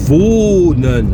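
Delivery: wohnen with a long O; careful German wohnen; German word. Shown as a demonstration. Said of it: "le verbe habiter qui se dit".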